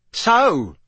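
loud, high, triumphant pounce; savage triumph. A narrator's reading. Mister Diggory looks down upon the elf, savage triumph. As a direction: pounce on the single word. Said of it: "with a kind of savage triumph".

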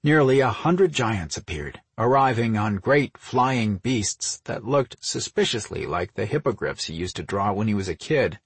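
usual speaking voice

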